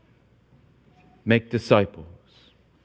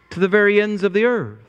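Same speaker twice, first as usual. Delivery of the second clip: higher, building exhortation from the pulpit; preaching at full force; 7 semitones higher than usual. Acted. Pastor urging the congregation to reach every nation, fervent preaching. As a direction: lift the voice and drive the command home.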